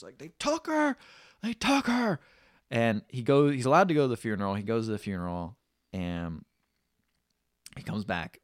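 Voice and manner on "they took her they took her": high, wailing repeat; grieving father repeating his loss, high and anguished; anguished cry. Retold or imitated.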